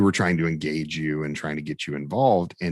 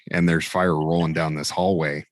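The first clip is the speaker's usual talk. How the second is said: ordinary delivery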